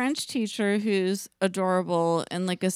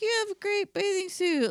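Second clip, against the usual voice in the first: put-on older lady voice; 10 semitones higher than usual. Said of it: "they'd just be like".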